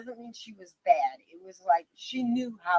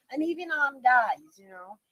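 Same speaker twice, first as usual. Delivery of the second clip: ordinary talk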